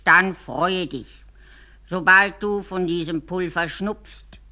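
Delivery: langsam, lauter, beschwörend; feierlicher alter Lehrer. Acted. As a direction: lies die Zauberformel gewichtig vor. Lehrer liest die Zauberformel vor. (alter Lehrer übersetzt die Formel, langsam und feierlich)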